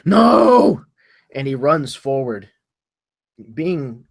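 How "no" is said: loud, high, drawn-out cry; anguished cry. Acted. Cleric who sees his companion fall, crying out loudly. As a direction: cry out in desperate protest. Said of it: "he cries out".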